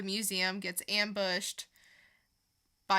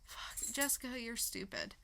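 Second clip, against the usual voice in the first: self-scolding mutter; 11 dB quieter than usual. Retold or imitated. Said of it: "And then I was like".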